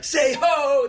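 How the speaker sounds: mock hype-man call; call to the crowd. Rapper hyping the crowd, mock call.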